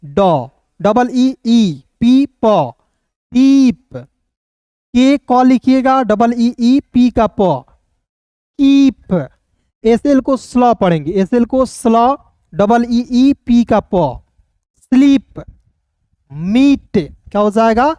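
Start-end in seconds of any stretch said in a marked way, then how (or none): 3.3-4.0 "दीप" higher, the ee held long; long drawn-out ee. Shown as a demonstration. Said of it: "थोड़ा सा खींच कर पढ़ते हैं". 8.6-9.3 "कीप" higher, with a long ee; long drawn-out ee. Shown as a demonstration. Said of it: "थोड़ा सा खींच कर पढ़ते हैं". 14.9-15.4 "स्लीप" higher, the ee held long; long drawn-out ee. Shown as a demonstration. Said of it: "थोड़ा सा खींच कर पढ़ेंगे".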